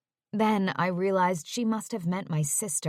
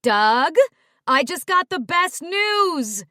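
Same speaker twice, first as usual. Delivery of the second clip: high loud call across the house; 10 semitones higher, 7 dB louder, slower than usual. Acted. Wife calling to her husband in the background, excited shout. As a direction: shout the news to the next room. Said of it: "call out to my father".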